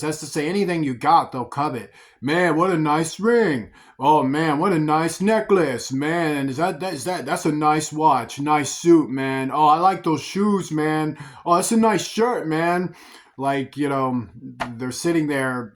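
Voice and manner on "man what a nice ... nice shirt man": ooh and aah over every possession; coveting smooth-talker. Retold or imitated. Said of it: "talking like that".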